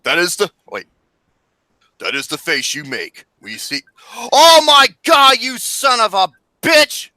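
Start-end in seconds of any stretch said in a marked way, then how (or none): 4.3-7.1 slow, high, very loud outrage; outraged yell. Real, not acted. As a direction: drag out each word in a loud yell. Yelling at friend over picture.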